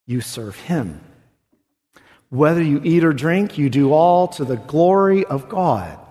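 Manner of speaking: a pastor comforting weary parents; tender encouragement. Real, not acted. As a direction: speak gently to tired listeners. Pastoral softer exhortation.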